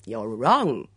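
loud, triumphant contradiction; mildly crowing, punched out loud. A narrator's reading. Suitor contradicts the woman sending him away, triumphantly. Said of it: "said Edmund triumphantly".

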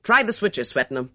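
loud colonel's command; ordering. A narrator's reading. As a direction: order it sharply, dropping on the name. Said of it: "he ordered".

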